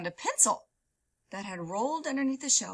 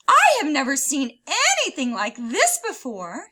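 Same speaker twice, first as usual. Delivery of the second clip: amazed librarian; 4 semitones higher, 9 dB louder than usual. Acted.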